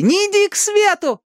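very high pleading cry; desperate wail. A narrator's reading. Ali begging Danny to avoid the light, wailing. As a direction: cry out to hold him back. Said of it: "вопила Али".

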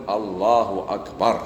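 slow, measured "الله أكبر" with drawn-out syllables; deliberate demonstration. Shown as a demonstration. Teacher shows what must be said in prayer, slow and deliberate. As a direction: stretch "الله أكبر" out carefully, word by word. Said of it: "في الصلاة لازم يقول".

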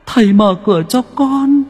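put-on very high, eerie child's wail calling its father; a ghost child begging to be let in from the cold. A narrator's reading. Ghost child at the door begs father, eerie high wail. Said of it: "Nó cất tiếng gọi".

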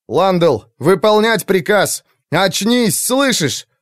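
high, loud urgent yelling; urgent shout. A narrator's reading. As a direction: shake him awake with your voice. Shaking a dazed mage.

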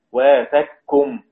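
ق assimilated into ك in واثقكم; idgham. Shown as a demonstration. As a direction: recite واثقكم slowly as "wathak-kum". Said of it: "يدغم الإمام السوسي هكذا".

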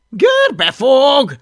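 high, loud, drawn-out call; thundering. A narrator's reading. Master reprimands his hunting dragon, thundering. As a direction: thunder the name, stretched out. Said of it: "mennydörögte Plépofa".

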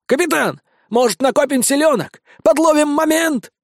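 high, coaxing parrot; the parrot ducks and pleads caution. A narrator's reading. (высокий голос попугая, уговаривает)

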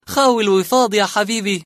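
mocking. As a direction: rub it in with a sneer. Suleiman mocks Murad.

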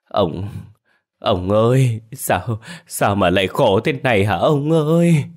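broken start building to a high wail; stammering, trembling grief. A narrator's reading. Wife collapsing before her husband's body, stammering grief. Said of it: "miệng bà run rẩy lắp bắp nói không thành tiếng".

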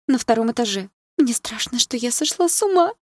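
high tearful voice, climbing at the end; sobbing. A narrator's reading. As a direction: confess the fear on the edge of sobs. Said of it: "снова всхлипнула Саша". (всхлипывая, жалобно)

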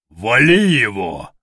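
slow, drawn-out battle call; attack cry. Acted. Soldier calls the gang onto the leader, bellowing. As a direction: stretch out the order to attack.

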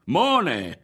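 high, loud shouted insult; angry cry. A narrator's reading. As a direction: hurl it at the group. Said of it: "gridò".

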